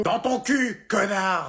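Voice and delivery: high, irritated holler; insulting yell. Acted. Mother-in-law answering son-in-law's call, rudely yelling. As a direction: yell the insult back across the house.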